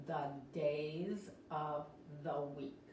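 slow, word-by-word model for learners; slow demonstration. Shown as a demonstration. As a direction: stretch each word quietly and carefully. Teacher models phrase slowly word by word.

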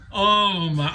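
ordinary talk

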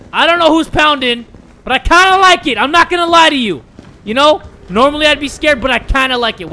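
high, loud, worked-up burst; loud excited rant. Real, not acted. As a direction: shout it over the pounding. Reacts loudly to pounding noise.

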